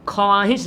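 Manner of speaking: ख्वाहिश with audible "w", "khowaahish"; read as written. Shown as a demonstration. Teacher showing the spelling, ख्वाहिश with voiced व. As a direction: sound the व in ख्वाहिश. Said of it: "लिखते हैं".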